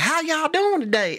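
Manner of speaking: high, sing-song mock Southern accent; imitating her Southern accent. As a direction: lightly ham up a Southern twang, pitched high. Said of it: "when she did the accent".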